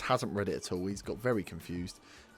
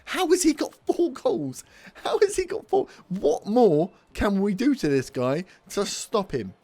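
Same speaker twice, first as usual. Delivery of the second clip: high, loud disbelieving shriek; outraged disbelief; 8 semitones higher, 7 dB louder than usual. Real, not acted. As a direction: scream in outrage at the goal. Outraged at fourth goal.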